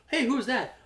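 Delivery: high, bright question; high, light voiced queen; high curious Dany.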